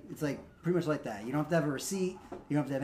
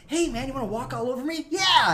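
much higher, louder pushover voice; mock doormat worker; 11 semitones higher, 8 dB louder than usual. Trader Joe's clerk inviting customers to walk on him, high mock voice. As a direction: play the eager doormat clerk.

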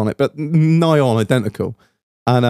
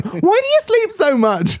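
much higher, whiny complaint; mock nagging. Someone questioning his lie-ins, mock nagging. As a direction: put on a high, nagging voice.

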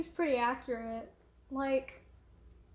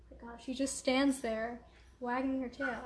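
ordinary talk

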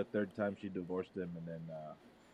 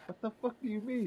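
mock shocked; 12 semitones higher than usual. Retold shocked reply to friend.

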